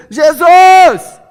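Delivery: very high, very loud cry; shout. Acted. Blind man shouting Jesus amid the noise. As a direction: yell over the crowd's chatter. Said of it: "e no meio o cego".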